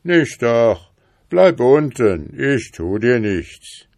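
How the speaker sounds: drawn-out reassuring shout; calling out. A narrator's reading. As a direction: call up slowly to someone climbing a tree. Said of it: "rief das wilde Tier ihm zu".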